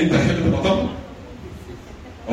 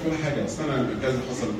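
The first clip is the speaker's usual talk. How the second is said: calmed-down reply; 3 semitones lower, 9 dB quieter than usual. Retold or imitated. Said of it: "يقول لك".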